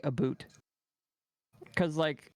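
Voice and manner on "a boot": "a boot" with a long oo; "a boot" for about. Retold or imitated. Disputing a YouTuber's accent, "a boot" with oo. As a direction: say "a boot", rhyming with suit. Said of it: "that would make him say".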